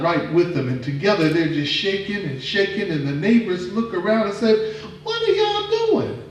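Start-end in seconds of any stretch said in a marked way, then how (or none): 5.0-6.2 much higher, slow curious villager; high neighbor voice. Acted. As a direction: stretch it out like a nosy neighbor. Neighbors ask about dance.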